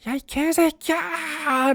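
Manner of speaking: high, drawn-out cursing yell; hammed-up anime hero cursing as he fires; mock dubbed battle cry. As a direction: stretch the curse into a high mock scream. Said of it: "라고 외치면서".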